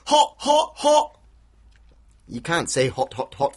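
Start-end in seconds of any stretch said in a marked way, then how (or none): 0.1-1.2 "Hot, hot, hot" high and loud with tongue out; silly tongue-out "Hot, hot, hot"; tongue sticking out. Shown as a demonstration. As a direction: say "Hot, hot, hot" dropping the final t, tongue hanging out. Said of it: "stick your tongue out and go".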